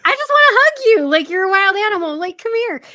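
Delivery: high, loud and quick, gushing; Buddy cooing at a raccoon; childlike gush. Retold or imitated. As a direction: coo at a wild animal like an excited kid. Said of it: "he's like".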